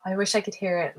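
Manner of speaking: normal talking voice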